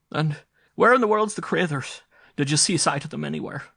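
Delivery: high, loud, anxious Irish mother; mother calling out for her missing children, alarmed; frightened Irish mother. A narrator's reading. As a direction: call out to the daughter in alarm. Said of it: "cried Missus Ryan".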